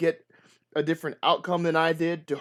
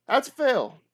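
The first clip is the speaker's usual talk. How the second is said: higher, louder mock-dismayed call; excited exclaim; 11 semitones higher, 5 dB louder than usual. Real, not acted. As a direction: announce the failure with a burst of energy. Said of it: "reacts to the failed roll".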